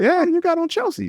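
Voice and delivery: very high, squeaky teasing voice; high-pitched mock admiration of Chelsea boots; mock impressed friend. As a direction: ham up a friend gushing over the boots. Mimicking a friend's reaction.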